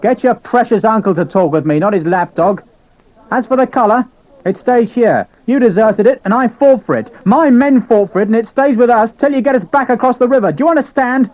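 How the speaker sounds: furious officer refusing to give up the colour; rising anger. A narrator's reading. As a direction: let the anger climb as he defends the flag. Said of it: "His voice was rising with anger".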